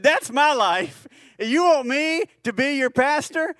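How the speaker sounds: high, slow, drawn-out incredulous question; retold panicked disbelief. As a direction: imitate a flustered man balking at the offer. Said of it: "he's hyperventilating at this point".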